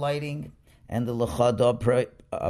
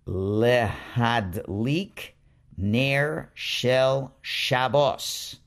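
very slow reading; much slower than usual. Shown as a demonstration. Teacher reading the candle blessing very slowly to students. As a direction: spell the words out in slow motion for beginners. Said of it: "read this page slowly and carefully".

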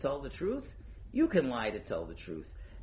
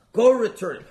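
much louder, higher order to take it back; loud demand; 10 semitones higher, 15 dB louder than usual. Retold or imitated. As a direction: bark the order to return it. Spouse demands return.

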